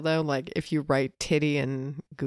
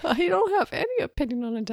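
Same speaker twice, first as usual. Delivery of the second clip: laughing through the words, quick and high; 9 semitones higher, faster than usual. Real, not acted. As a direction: giggles while denying any opinion.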